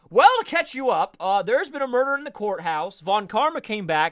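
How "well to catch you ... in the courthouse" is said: loud, high catch-up; eager, a bit louder; excited. Real, not acted. Greets a late friend excitedly.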